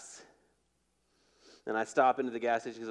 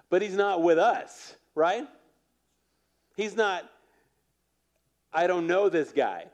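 higher, louder, whiny objection; mock complaint; 6 semitones higher, 6 dB louder than usual. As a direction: play the petty disciple protesting. Mimics disciples' complaint.

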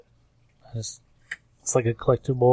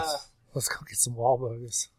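whispering; 7 dB quieter than usual. Real, not acted. Whispered joke aside.

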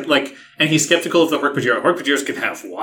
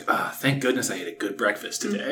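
soft mock mutter; 4 semitones lower, 9 dB quieter than usual. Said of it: "he doesn't mutter".

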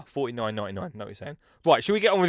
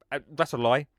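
ordinary talk

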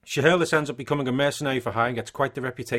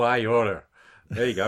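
John Wayne impression. Imitating his American co-host as John Wayne. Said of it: "I'm John Wayne now".

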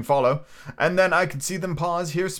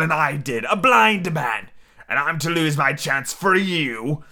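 raised pirate tirade, pushed higher; berating rant; 5 semitones higher, 3 dB louder than usual. A narrator's reading. Blind Pew berating his fools of a crew, ranting. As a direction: scold the cowards, stretching key phrases.

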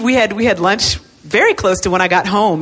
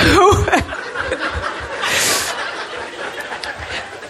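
long breathy laugh trailing away; drawn-out amused laughter; 8 semitones higher, 5 dB quieter than usual. Real, not acted. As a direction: laugh helplessly, letting it fade out. Laughs after her joke.

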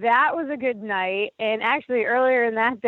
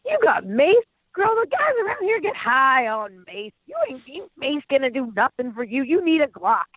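high, bright imitation of a chatty woman from a car; roadside stranger; 4 semitones higher than usual. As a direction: play the nosy friendly stranger calling from her car. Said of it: "She just got some mace".

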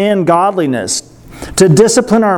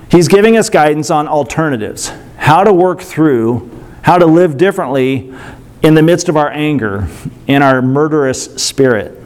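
usual speaking voice

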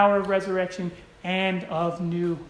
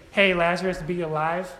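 casual, mild; 2 semitones lower than usual. Acted. Preacher showing what Jesus didn't say, casual and mild. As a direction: say it with no force at all. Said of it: "Jesus didn't say".

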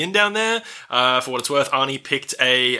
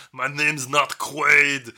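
slow Arnie-ish denial; 3 semitones lower, much slower than usual. Acted.

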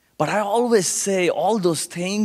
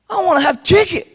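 high, loud excited request; eager delight; 13 semitones higher, 5 dB louder, faster than usual. Retold or imitated. Hungry boy offered chicken, eager delight.

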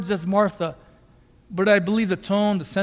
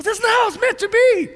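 a much higher, pained cry of outrage; angry outburst; 13 semitones higher, 4 dB louder than usual. Retold or imitated. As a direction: voice Jesus protesting, high and upset. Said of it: "He's angry".

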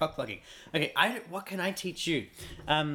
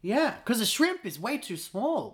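higher, lively mock outrage; indignant correction; 7 semitones higher than usual. Real, not acted. Correcting a friend about Aussie shrimp, high indignant. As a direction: defend the prawn with gusto.

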